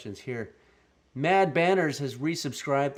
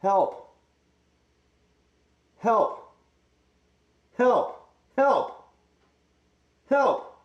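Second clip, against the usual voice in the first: calls building louder and higher; mock cries for help; 9 semitones higher, 9 dB louder, much slower than usual. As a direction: cry for help inside the helmet, rising. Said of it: "Might need a sound test with the helmet".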